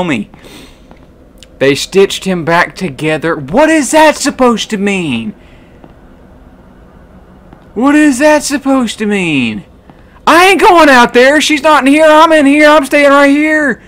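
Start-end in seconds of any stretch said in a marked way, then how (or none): 3.4-9.6 alarmed. Real, not acted. Alarmed by game text. 10.3-13.8 high, loud, alarmed insistence; panicked refusal. Real, not acted. Scared, refusing to go out.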